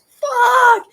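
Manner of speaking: high, loud frustrated curse; angry outburst. Retold or imitated. Inner anger erupting once the task is done, loud curse. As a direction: let the inner anger explode. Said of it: "And the inner anger is like".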